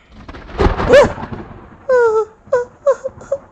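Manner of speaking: high loud sobbing gasp; loud rising baby sob; crying gasp. Acted. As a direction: break into a baby's cry. Baby bursts into tears.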